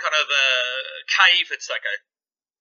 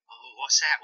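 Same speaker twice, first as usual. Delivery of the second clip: ordinary delivery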